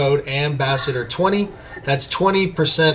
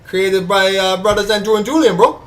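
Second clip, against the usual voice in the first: higher, hammed-up Cuban gangster accent; Tony Montana accent; 4 semitones higher than usual. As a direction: brag about the brothers like a Cuban gangster, in a mock Cuban accent. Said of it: "Dave suggested Tony Montana".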